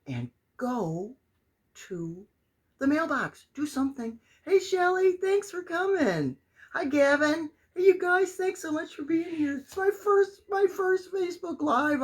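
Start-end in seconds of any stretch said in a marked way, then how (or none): 4.4-12.0 high, loud gushing hello; excited greeting. Real, not acted. Welcoming friends to her first Facebook Live, high and excited. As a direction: burst out welcoming the newcomers.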